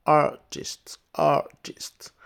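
"Artist" said "a-tist", no R sound at all; teacher models "Artist" as "a-tist"; silent R. Shown as a demonstration. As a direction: drop the R in "Artist", open A. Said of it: "On dit c'est R, mais c'est A".

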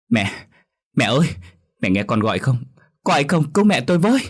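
acted wail building into a high, loud cry for help; anguished wailing. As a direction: call out to mother, rising to a desperate scream. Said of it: "gào khóc thảm thiết". (gào khóc thảm thiết, kêu cứu)

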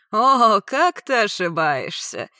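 loud drawn-out gloating; mocking. A narrator's reading. Attacker answering her denial, mocking. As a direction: swell on the opening, then draw it out mockingly.

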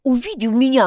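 usual speaking voice

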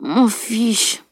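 sleepy, muffled grumble; grumbling. A narrator's reading. Sleepy friend grumbles back at Camille, grumpy. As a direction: mumble it from under the blanket. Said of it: "grommela son amie".

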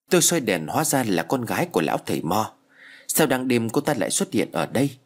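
ordinary delivery

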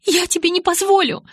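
high, loud shouted refusal; desperate shout. Acted. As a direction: shout him down. Bella shouts at Jacob.

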